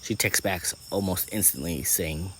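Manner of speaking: ordinary delivery, nothing marked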